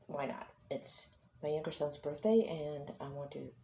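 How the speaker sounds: ordinary talk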